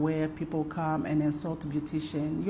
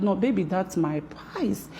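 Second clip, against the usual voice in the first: ordinary talk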